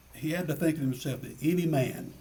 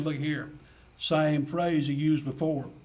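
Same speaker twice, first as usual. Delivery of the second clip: normal talking voice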